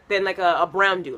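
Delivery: normal talking voice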